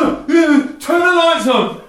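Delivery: high, loud, slow bratty demand; bossy kid. Acted. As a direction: mimic a child yelling orders at a device. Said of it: "generation of kids running around going".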